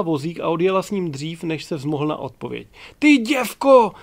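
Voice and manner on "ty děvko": high, loud outraged yell; furious shout. A narrator's reading. Passenger yells after the departed stewardess, furious shout. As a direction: shout the insult at full force. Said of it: "vykřikl".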